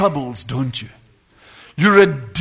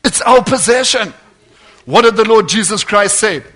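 louder, driving sermon cadence; preaching at full force; 3 semitones higher, 7 dB louder than usual. Acted. Preacher urging believers to use their authority, full force. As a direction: preach it hard to the congregation.

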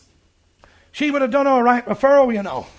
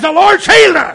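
loud, higher-pitched retold shout of faith; triumphant proclamation; 5 semitones higher, 9 dB louder than usual. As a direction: proclaim the miracle at full voice. Son triumphantly announces the healing.

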